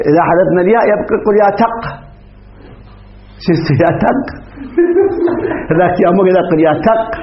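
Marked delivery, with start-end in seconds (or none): 4.6-5.7 a quick high laugh at the pun; short laugh. Real, not acted. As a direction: laugh briefly at the joke. Teacher laughs at the joke. (ضحكة قصيرة)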